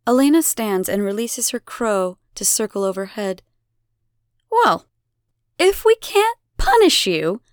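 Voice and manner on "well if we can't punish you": high, loud theatrical helplessness; mock-helpless sigh. A narrator's reading. Elena addresses the defiant child, mock-helpless. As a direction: feign being at a loss. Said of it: "Elena sighs, helpless".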